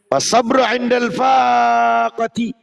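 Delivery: proclaimed slowly and loudly; loud slow recitation. Shown as a demonstration. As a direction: call out the Arabic phrase to the room. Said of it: "recited loudly as heading". (dibacakan lantang dan pelan)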